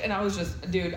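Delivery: ordinary talk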